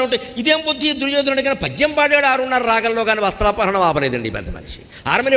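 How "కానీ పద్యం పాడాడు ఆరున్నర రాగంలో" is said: usual speaking voice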